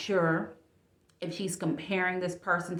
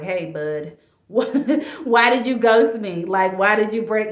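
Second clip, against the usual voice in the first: laughing mock plea; 3 semitones higher, 8 dB louder, slower than usual. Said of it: "going back to this guy like".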